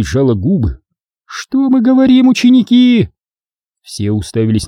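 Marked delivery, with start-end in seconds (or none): 1.2-3.1 high, loud cue to the class; high loud teacher prompt. A narrator's reading. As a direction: call for the chant. Obin prompting chant.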